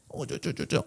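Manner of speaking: very soft doting babble; baby-talk babble. Retold or imitated. Owner fussing over a dog, soft babble. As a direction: imitate gushing over a pet, barely voiced. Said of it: "get some more warmth in it".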